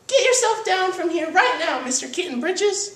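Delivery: very high cartoon mother giving orders; hammed-up bossy mama voice; scolding mama. A narrator's reading. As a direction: order the kitten down, high-pitched and cross. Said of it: "ordered Mama Junebug Jones".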